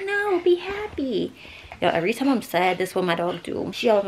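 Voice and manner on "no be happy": very high, drawn-out pet voice; high cutesy imitation of a dog; dog's voice. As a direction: voice the dog in a slow squeal. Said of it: "She's like".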